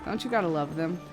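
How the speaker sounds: ordinary delivery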